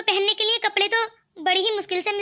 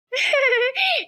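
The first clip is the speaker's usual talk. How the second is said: cheeky laugh; 5 semitones higher than usual. Acted. Devar laughs after ordering tea.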